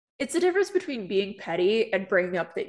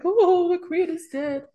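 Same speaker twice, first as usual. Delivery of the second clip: mock distraught mourner; 10 semitones higher, 6 dB louder, slower than usual. Mocking distraught settler Canadians.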